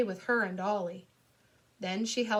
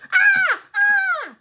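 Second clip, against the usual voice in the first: very high, loud scare scream; 14 dB louder than usual. Acted. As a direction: shriek to frighten trick-or-treaters. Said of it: "I screamed".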